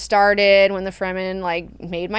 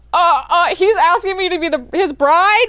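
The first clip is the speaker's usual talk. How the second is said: high, loud and fast, caught off guard; sputtering mock shock; shocked disbelief; 9 semitones higher, 7 dB louder than usual. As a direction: blurt it out, stunned by the proposal. Hwi shocked at the proposal.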